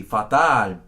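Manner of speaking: fatal with two open ah vowels; both A's as pure ah; Spanish fatal. Shown as a demonstration. Teacher models Spanish fatal for student, open ah vowels.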